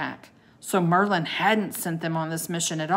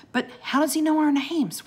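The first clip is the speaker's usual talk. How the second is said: usual speaking voice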